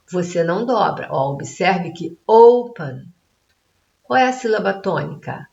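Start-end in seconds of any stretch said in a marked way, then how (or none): 2.3-3.0 "open" with a loud, stretched "O"; stress on first syllable. Shown as a demonstration. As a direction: punch the opening "O" of "open". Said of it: "A primeira sílaba, que é o O". ("open" com ênfase forte no "O" inicial)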